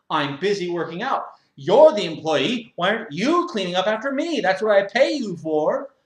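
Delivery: high, loud self-righteous whine; whiny gym member. Acted. Member defends his mess to the owner, loud high whine. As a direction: complain like a spoiled man-child.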